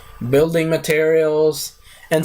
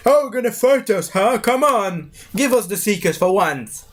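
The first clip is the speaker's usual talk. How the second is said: higher mocking taunt; taunting priest; 6 semitones higher than usual. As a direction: sneer at him like a villain. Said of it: "the priest is like".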